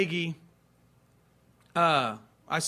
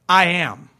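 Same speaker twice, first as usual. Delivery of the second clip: loud, weighty declaration; Jesus' solemn answer; 10 dB louder than usual. Acted. Jesus answering the council, loud solemn declaration. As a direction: declare it with full authority. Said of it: "And Jesus said".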